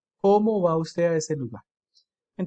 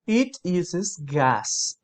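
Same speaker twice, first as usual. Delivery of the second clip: very slow model; much slower than usual. Shown as a demonstration. Teacher to students, modelling the sentence very slowly. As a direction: drag out each word of the English example. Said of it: "aquí está el ejemplo".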